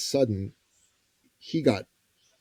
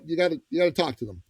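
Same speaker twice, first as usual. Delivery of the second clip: normal talking voice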